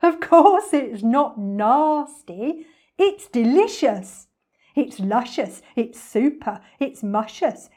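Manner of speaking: loud, relishing, rhythmic villain patter; gloating storybook villain; gleeful crocodile. Acted. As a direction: build a list of treats with greedy delight.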